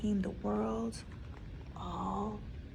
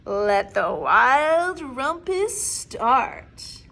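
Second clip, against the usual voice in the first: louder, very slow declaration; grand proclamation; 9 dB louder, much slower than usual. Acted. Max proclaims the rumpus to the wild things. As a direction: announce the party grandly and slow. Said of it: "cried Max".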